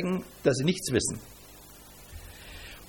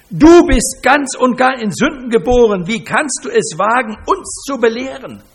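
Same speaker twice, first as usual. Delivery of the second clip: higher and much louder furious rebuke; outraged rebuke; 6 semitones higher, 9 dB louder than usual. Retold or imitated. Pharisees berating the healed beggar, outraged shouting. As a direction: blast him for his cheek.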